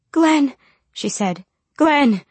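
high, loud call of the name; distressed call. A narrator's reading. Mother calls out to frantic man.

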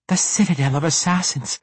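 name it in a high, urgent voice; fearful disclosure. A narrator's reading. Captive names the feared band to her interrogator, fearful disclosure.

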